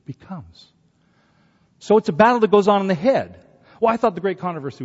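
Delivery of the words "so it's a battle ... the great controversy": loud, fast punch of a line; preaching burst. Acted. Preacher to congregation, loud and fast. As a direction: hit the congregation with it.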